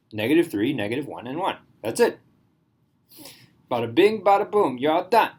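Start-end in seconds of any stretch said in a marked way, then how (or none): 3.7-5.3 playful hint of an Italian accent, louder and higher. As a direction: toss it off in a light mock-Italian voice. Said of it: "got into my Italian voice".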